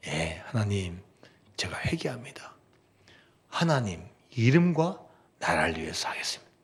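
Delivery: soft unvoiced confession to God; whispered repentance. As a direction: breathe a humble vow of repentance.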